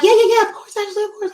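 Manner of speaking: high, eager mock yes-voice; eager client. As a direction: play the overeager client agreeing fast. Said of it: "They were like".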